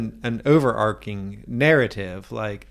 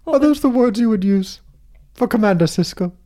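high, hooting hammy delivery; Commander Sisko voice; 8 semitones higher than usual. Retold or imitated. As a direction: overact like a starship captain. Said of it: "What is this voice?".

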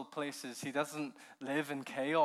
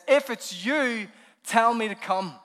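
loud and slow, daring; Peter's fearless request shouted across the waves; courageous plea; 7 semitones higher, 14 dB louder than usual. Acted. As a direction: call out boldly to be summoned. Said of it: "Ridiculous courage and faith".

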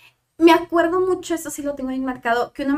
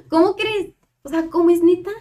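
higher, louder astonished reaction; retold disbelief; 5 semitones higher, 6 dB louder than usual. Student hears she was selected for the placement, retold disbelief. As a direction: relive the stunned delight at the news.

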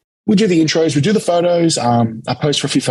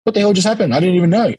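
amazed disbelief; 6 semitones higher, faster than usual. Retold or imitated. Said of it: "I'm like".

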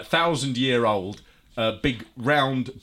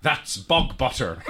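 put-on slow Irish-accented "That's bog butter." with a laugh; mock Irish accent; slower than usual. Host quoting the farmer's wife in a slow Irish accent. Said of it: "racist accent".

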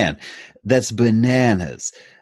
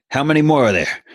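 high, loud alarmed call; alarmed question; 8 semitones higher, 4 dB louder than usual. Retold or imitated. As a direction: demand it in sudden alarm. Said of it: "We're like".

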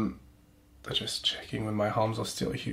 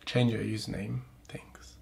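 whisper; 5 dB quieter than usual. Real, not acted. Aside to fan.

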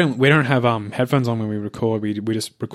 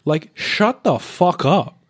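drawn-out, exasperated put-down, higher pitch; mock scolding; 5 semitones higher than usual. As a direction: tell off an imaginary whiner with swooping emphasis. Mock scolding an imagined complainer.

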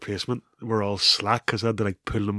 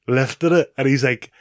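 normal talking voice

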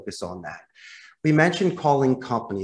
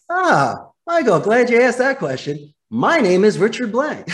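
louder, higher, beaming phone reply; enthusiastic buffer; 8 semitones higher, 5 dB louder than usual. Shown as a demonstration. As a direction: greet him like great news. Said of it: "Here's another buffer boomerang".

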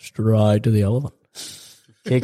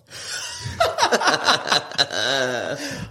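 crying laughter; 9 semitones higher, 4 dB quieter than usual. Real, not acted. Laughing after the punchline.

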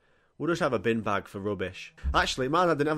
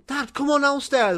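high, loud whiny call up the stairs; impatient call; 14 semitones higher, 6 dB louder than usual. Retold or imitated. Kid calling dad to come downstairs, impatient. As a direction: plead with dad to hurry.

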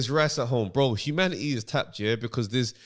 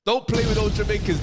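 ordinary talk, nothing marked

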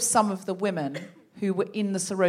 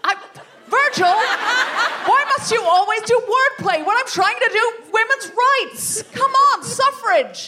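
high, loud, indignant rallying cry; fired-up suffragist leader, louder and higher; rousing ringleader; 12 semitones higher, 6 dB louder than usual. Acted. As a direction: stir up the club against the press men. Improv as Jenny June.

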